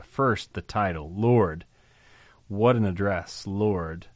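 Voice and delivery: normal talking voice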